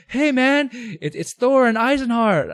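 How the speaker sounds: high, slow excited greeting; friendly call. Retold or imitated. Clanmates greet him from the stands. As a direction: wave him over, friendly and eager. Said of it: "they're like".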